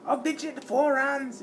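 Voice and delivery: very high, put-on Irish voice; badly faked Irish challenge; bad Irish accent. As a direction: attempt Irish trash talk in falsetto. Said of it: "I can't do an Irish accent".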